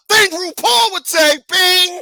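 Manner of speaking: high, loud, slow host announcement; a shouted mock game show reveal. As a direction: call out the category like a TV host.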